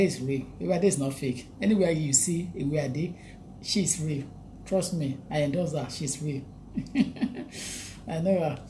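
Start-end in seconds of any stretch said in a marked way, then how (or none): none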